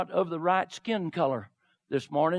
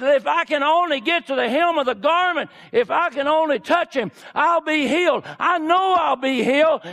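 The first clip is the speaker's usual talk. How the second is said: higher, louder fervent plea; fervent crying out; 8 semitones higher, 5 dB louder than usual. Acted. Sick woman pushing through the crowd to Jesus, fervent higher plea. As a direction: press toward him with fierce hope.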